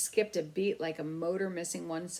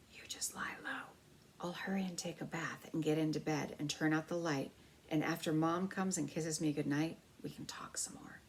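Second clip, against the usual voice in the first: secret whisper to a hidden mouse; 5 dB quieter than usual. A narrator's reading. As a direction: hush it so the parents can't hear. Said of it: "he whispered to Ralph".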